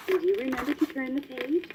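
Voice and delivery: ordinary delivery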